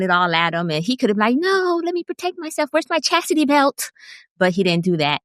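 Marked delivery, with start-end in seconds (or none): 1.4-3.9 high-pitched put-on protest; mock panicked refusal. Voicing what the man could have said, mock panicked refusal. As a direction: squeal a hammed-up refusal, far above your own voice.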